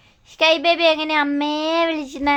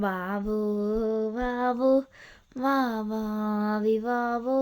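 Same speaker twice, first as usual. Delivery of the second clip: soothing lullaby murmur; 4 semitones lower, 7 dB quieter than usual. Real, not acted. Mother putting her daughter to sleep, soothing. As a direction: lull gently, drawing out the last coos.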